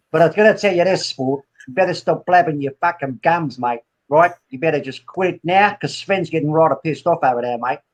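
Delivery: mock-threatening Aussie; Australian accent. Warning a mate that Sven's annoyed, Australian accent. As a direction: warn them like an Aussie bloke.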